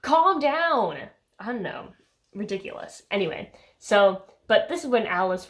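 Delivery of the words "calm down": high, drawn-out telling-off; exasperated plea to settle down; mock exasperation. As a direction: scold it from a raised pitch. Telling Bella off.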